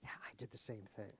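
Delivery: hushed, quick confession; a quiet, fast secret confession; imitated whisper. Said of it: "whisper to me".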